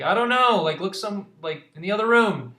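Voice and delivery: fed-up leader giving orders; Ballister impatient. Acted. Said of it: "He's like".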